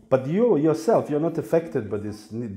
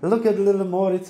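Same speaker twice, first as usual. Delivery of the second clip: delighted rabbit mother; 8 semitones higher, 5 dB louder than usual. Retold or imitated.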